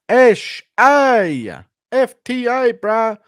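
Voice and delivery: very high, loud, drawn-out holler; Tai Tuivasa impression. Tai Tuivasa shouting out his area, high drawn-out impression. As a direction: stretch the words out loud and high. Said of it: "Taito Ivasa impression".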